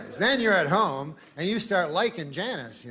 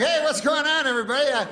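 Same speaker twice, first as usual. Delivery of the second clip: cheery shout; 9 semitones higher, 3 dB louder than usual. Pretend. Janice imagined strolling out.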